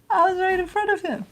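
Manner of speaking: high, laughing sing-song. Real, not acted. Amused that the dog missed her, high laughing coo. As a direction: giggle it fondly about the dog.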